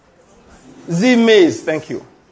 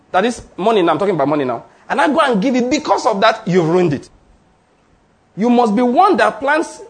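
ordinary talk

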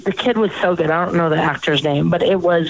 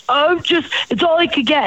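higher-pitched, shrugging it off; breezy excuse; 7 semitones higher than usual. Retold or imitated. Friend excusing the drug he gave, breezy excuse. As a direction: imitate the friend brushing off blame.

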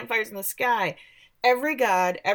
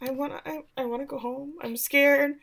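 higher, softer, childlike mock-scared plea; a touch of a frightened kid; scared whimper; 7 semitones higher, 4 dB quieter than usual. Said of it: "Riley is like".

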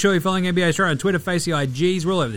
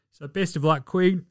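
normal talking voice

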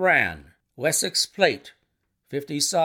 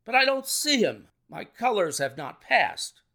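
great agitation; 7 semitones higher than usual. A narrator's reading. Colonel searching for his colors among the horses, agitated. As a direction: cry out anxiously, then ease a little. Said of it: "cried the colonel in great agitation".

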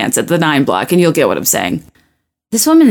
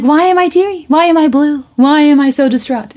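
high mock-whiny voice; mock self-pity; 8 semitones higher than usual. Mocking her overthinking self to viewers, high and whiny. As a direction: ham up your own moping. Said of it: "doing it to myself and being like".

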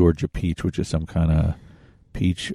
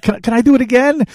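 high, excited mock kid; bouncing with excitement, much higher; 4 dB louder than usual. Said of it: "ran around".

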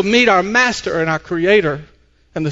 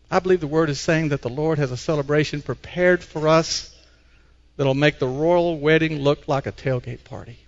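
ordinary talk, nothing marked

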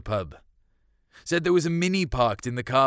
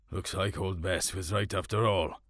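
dad; 4 semitones lower, 4 dB quieter than usual. A narrator's reading. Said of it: "Dad said".

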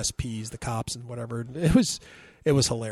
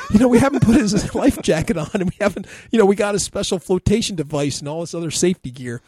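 higher, louder anxious parent through laughter; fretting parent, laughing; 7 semitones higher, 7 dB louder than usual. Retold or imitated. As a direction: parent listing missing safety gear. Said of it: "They're like".

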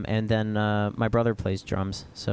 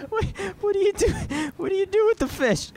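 laughing. Real, not acted. Laughing through the bit.

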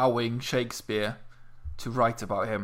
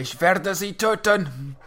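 mock menacing German; 5 semitones higher, slower than usual.

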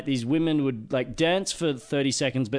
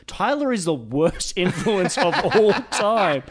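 laughing; 5 semitones higher, 4 dB louder than usual. Real, not acted. Laughing at own story.

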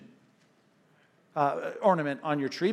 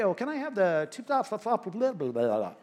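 imitating a mumbler; 4 semitones higher than usual. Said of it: "he was an expert mumbler".

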